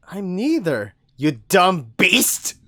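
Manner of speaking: loud, slow, spat insult; angry shout. Acted. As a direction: spit the insult at him. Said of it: "I spit out in anger".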